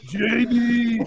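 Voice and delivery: put-on loud, high, drawn-out Austrian-accented call; mock Austrian holler; Schwarzenegger. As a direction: bellow it like an Austrian action hero. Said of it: "do a Schwarzenegger impersonation".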